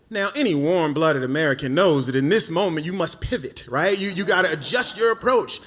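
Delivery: ordinary talk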